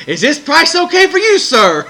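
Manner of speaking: high, loud sarcastic politeness; imitating a clerk's pointed courtesy; mock polite clerk. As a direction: ask it sweetly to needle him. Clerk mocking the earlier customer.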